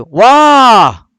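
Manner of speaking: high, loud exclaimed "Wah"; intonasi tinggi. Shown as a demonstration. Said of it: "Intonasi dia mesti ting". (sebut "Wah" tinggi dan lantang)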